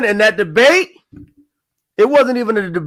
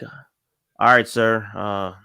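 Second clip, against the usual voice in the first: low-pitched Southern guy; rough attempt at a Southern accent; 11 semitones lower, 5 dB quieter, much slower than usual. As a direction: imitate a Southern man in a low voice. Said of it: "had a Southern accent".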